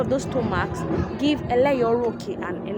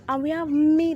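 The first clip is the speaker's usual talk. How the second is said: high tone demo; 6 semitones higher, 7 dB louder than usual. Shown as a demonstration. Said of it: "It is the flying tone".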